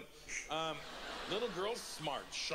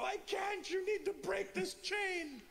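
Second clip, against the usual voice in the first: very high, strained plea; acted hysterics, squeaking the words out; slow falsetto wail, as if cracking up; laughing helplessly; slower than usual. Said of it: "the more I would laugh".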